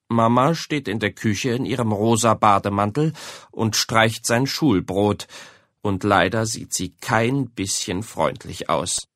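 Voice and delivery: usual speaking voice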